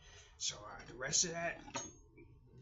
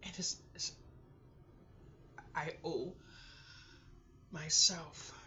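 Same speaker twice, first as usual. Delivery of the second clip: halting words with long pauses, sliding into whisper; fighting back tears; 3 semitones higher, 3 dB quieter, slower than usual. Real, not acted. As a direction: plead with yourself not to cry, breath giving out. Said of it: "to cry".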